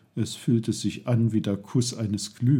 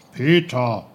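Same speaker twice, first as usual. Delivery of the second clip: loud call of the name; 7 dB louder than usual. A narrator's reading. Uncle calls to the boy lost in thought, calling out. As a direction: sound out suddenly to get the boy's attention. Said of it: "ertönte Onkel Pfefferkorns Stimme".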